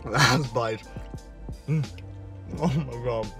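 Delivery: ordinary talk, nothing marked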